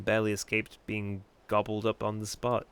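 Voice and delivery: ordinary talk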